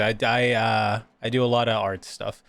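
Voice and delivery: normal talking voice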